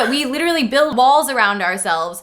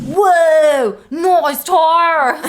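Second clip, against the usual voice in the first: high, loud stoner dude; 5 semitones higher, 4 dB louder, much slower than usual. Acted. Jacob admiring Edward's car as a dude. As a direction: drawl it like a surfer bro. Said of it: "Jacob's like".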